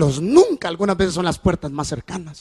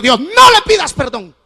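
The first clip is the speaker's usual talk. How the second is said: daughter's loud rebuke; 3 semitones higher, 7 dB louder, slower than usual. Retold or imitated. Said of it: "Y me dijo".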